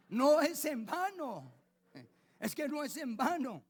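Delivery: loud, slow, high insistence; emphatic preaching. Real, not acted. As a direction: drive home the point with force.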